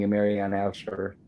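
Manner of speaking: ordinary delivery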